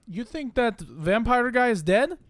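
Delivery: slow, incredulous Russian question; Russian accent. Acted. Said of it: "Welcome to my Russian accent".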